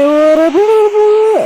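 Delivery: very high, slow stretched अरे भाई; drawn-out wail. Real, not acted. Gamer wailing when his shot just misses. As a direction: wail it out in disbelief. Frustrated near-miss shot.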